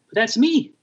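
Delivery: high, protesting mock-indignation; imitating Monk's outraged protest; indignant Monk. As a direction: insist everyone knows you.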